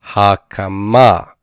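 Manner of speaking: "hakama" drawn out slowly to a strong MA; stress on final syllable. Shown as a demonstration. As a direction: land on the last syllable of "hakama". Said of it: "Not hakama, but hakama".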